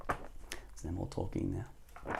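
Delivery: hushed, slow whisper. Real, not acted. Reader hushes as Gregory falls asleep, whispering slowly. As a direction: whisper so you don't wake him. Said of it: "soft aside as child sleeps".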